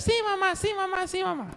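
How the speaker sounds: put-on very high, tiny kid voices; little goats answering. Little goats dutifully answering their mother in tiny high voices. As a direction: squeak it like obedient little goats.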